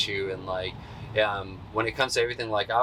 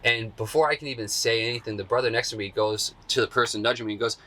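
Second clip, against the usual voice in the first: usual speaking voice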